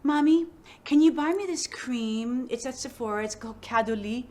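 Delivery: daughter's voice. Retold or imitated. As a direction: pitch it up like a ten-year-old begging for a cream.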